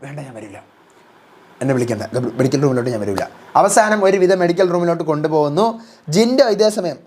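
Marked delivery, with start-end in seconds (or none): 0.0-3.2 low, soft sulking refusal; sulky Gabri. Acted. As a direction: mumble the refusal deep and low. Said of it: "Gabri പറയാണ്".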